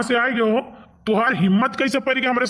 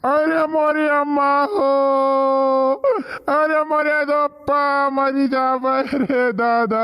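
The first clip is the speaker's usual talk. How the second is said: mock wailing; 3 semitones higher, slower than usual. Beaten vendor wails.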